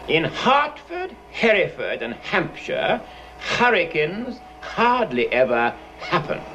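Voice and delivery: slow, with every H in "hurricanes" puffed out; demonstrated H sounds. Shown as a demonstration. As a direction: breathe a strong H onto "Hertford", pause between words. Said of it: "listen carefully".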